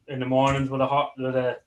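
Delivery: usual speaking voice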